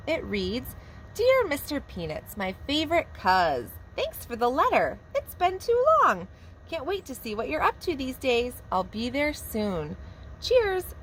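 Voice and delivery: lively, sing-song storybook reading; animated picture-book narration for little kids; reading to children. A narrator's reading. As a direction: read it like a preschool teacher at story time. Teacher reads picture book aloud.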